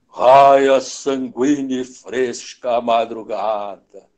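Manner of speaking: low, slow booming declamation; vozeirão de Nelson Rodrigues. Retold or imitated. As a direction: put on a great big voice and draw the line out. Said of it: "Falava com aquela vozeirão dele". (imitando um vozeirão grave e arrastado)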